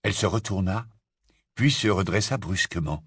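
usual speaking voice

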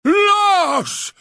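loud, high, drawn-out bellow; howled. A narrator's reading. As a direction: bellow the insult in fury. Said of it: "hurla Hagrid".

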